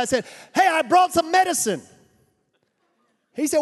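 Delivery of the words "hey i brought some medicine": louder, higher call across a distance; shouted across. Retold or imitated. Missionary shouting to a villager at the village edge, loud call. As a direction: shout it out to a man far off. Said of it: "So I shouted at the guy".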